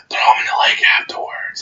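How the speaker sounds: sly whispered aside; conspiratorial hushed whisper. Retold or imitated. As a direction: slip the instruction in under the breath, all whispered. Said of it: "he's like whispering to me".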